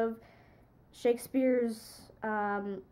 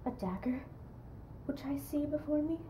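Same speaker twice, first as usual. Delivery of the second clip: haunted soliloquy; 3 semitones lower, 9 dB quieter than usual. Acted. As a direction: speak softly to the dagger, almost whispering in places.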